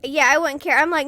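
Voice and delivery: ordinary talk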